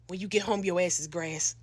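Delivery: low, menacing mom warning; mother's threat. Retold or imitated. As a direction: imitate a mother's ominous promise.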